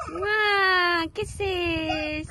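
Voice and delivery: high, drawn-out cooing greeting. Real, not acted. Mother greets her baby. (masigla at malambing na bati sa sanggol)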